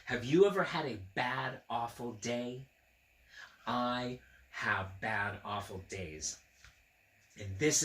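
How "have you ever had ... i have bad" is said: ordinary delivery, nothing marked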